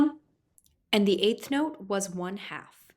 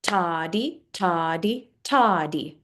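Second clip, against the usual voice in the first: two quick syllables per beat; rhythmic toddy count; slower than usual. Shown as a demonstration. Teacher counting eighth notes, rhythmic toddy. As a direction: chant toddy in even eighths. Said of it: "with each of my claps on the beat".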